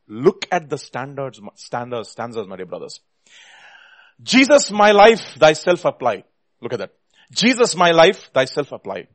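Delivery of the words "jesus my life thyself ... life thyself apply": loud, high and slow opening on each line; impassioned hymn reading. Real, not acted. Preacher reading Wesley's hymn to his brothers, impassioned. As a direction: proclaim it like a preacher.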